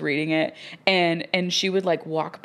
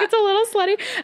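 laughing reply; 4 dB louder than usual. Retold or imitated. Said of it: "I was like".

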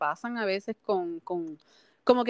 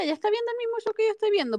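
much higher, fast, disbelieving; incredulous high voice; 12 semitones higher, much faster than usual. Retold or imitated. Viewer quoting her own disbelief, high and fast. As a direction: squeak out the disbelief. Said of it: "Ahí fue que yo dije".

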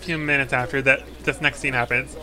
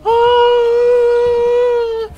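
high, loud, sustained choral "aah"; choir singing; 8 dB louder than usual. Acted. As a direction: hold one long high choir note. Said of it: "you hear people saying".

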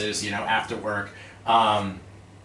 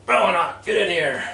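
boss calling him in; 7 semitones higher, 4 dB louder than usual. Retold or imitated. Boss summons him to office.

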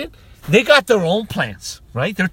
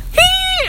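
very high, loud squealed "hee"; piercing "hee"; 6 dB louder than usual. Acted. Man shows how he'd hand out candy, high squeal. Acting out what he'd have done.

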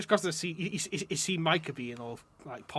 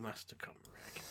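whispering; 6 semitones lower, 19 dB quieter, slower than usual. Pretend. Mock title after thanks.

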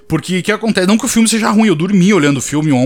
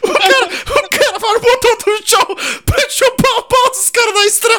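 high, breathless laughing; helpless laughter; slower than usual. Real, not acted. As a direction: laugh so hard the words break. Storyteller collapsing into laughter.

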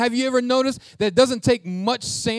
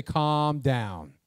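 patronizing calm down; 6 semitones lower than usual. Retold or imitated. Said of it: "and you say".

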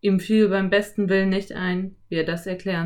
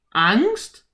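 put-on indignant rising boy cry; boy offended at being called scared; 4 semitones higher, 6 dB louder than usual. A narrator's reading. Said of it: "rief Philipp empört". (empört)

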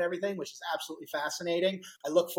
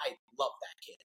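leaning-in murmur; 5 semitones lower, 8 dB quieter, faster than usual. Retold or imitated. COO leans over during talk.

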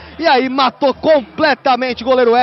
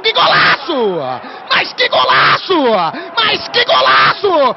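rising goal cry, higher each time; explosive goal commentary; 3 semitones higher, slower than usual. Real, not acted. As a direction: stretch the goal call out. Goal scored, narrator erupts.

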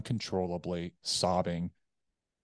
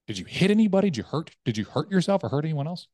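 high, loud, insistent imitated questions; urgent questioning; 8 semitones higher, 7 dB louder than usual. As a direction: fire questions at him as a worried buddy. Buddy urgently questioning him on phone.

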